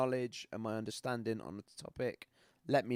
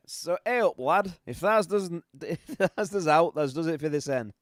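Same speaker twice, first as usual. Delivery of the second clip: loud, higher-pitched Sheffield accent; broad northern English accent; 4 semitones higher, 7 dB louder than usual. Shown as a demonstration. Streamer showing viewers he's from up north, hammed-up Sheffield accent. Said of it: "it'll be a northern accent".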